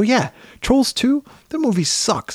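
slow, high-pitched retold scorn; retold disgusted rant. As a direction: draw it out high, retelling your own disgust. Retold rant to his son.

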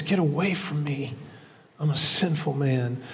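almost under the breath, ashamed; ashamed plea. Retold or imitated. Peter before Jesus after the catch, hushed and ashamed.

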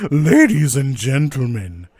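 booming, drawn-out arena announcer; big showman build-up, loud and high; loudspeaker announcer. Acted. Game master introduces three challengers to the arena, booming announcer voice. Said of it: "you hear a voice over a loudspeaker".

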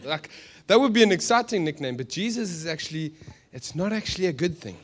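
ordinary talk, nothing marked

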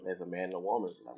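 ordinary talk

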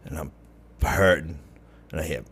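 ordinary talk, nothing marked

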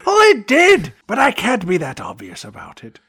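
sudden high squeak, then settling back; a hammed-up coy villain answer; mock sly villain. Dub of Bison.

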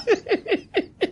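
high, hearty burst of laughter; demo laugh. Shown as a demonstration. Pastor demonstrating laughter at being fired, high laugh. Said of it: "Just start laughing".

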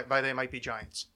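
ordinary talk

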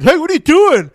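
high, loud indignant yell; indignant shout. Retold or imitated. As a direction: call out at the thief. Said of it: "the guy's like".

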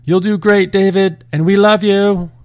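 high, loud call to someone leaving; yelled after him. A narrator's reading. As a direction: call it out across a distance. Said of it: "mother and sister yelled after him".

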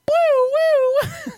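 very high, loud siren wail; piercing mock police siren; imitated siren whoop. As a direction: whoop like a patrol car siren. Imitating the ranger's siren arriving.

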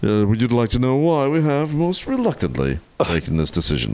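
put-on formal English accent, letter read aloud; posh English audiobook character. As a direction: read it like a stuffy BBC Englishman. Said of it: "by your accent".

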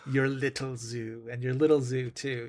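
a hint of a sneering Nazi; mustache twirling villain. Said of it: "When he sneers at her".

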